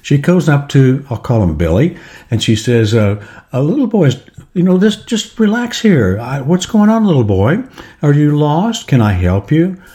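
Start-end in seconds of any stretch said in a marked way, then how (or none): 3.5-9.8 higher-pitched, lightly acted grandma; a hint of a well-meaning granny, soothing a lost child; lightly voiced grandmother. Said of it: "there's a little old grandmother".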